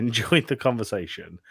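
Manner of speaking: ordinary talk, nothing marked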